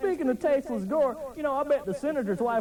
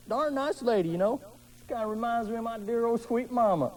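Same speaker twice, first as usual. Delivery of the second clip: ordinary talk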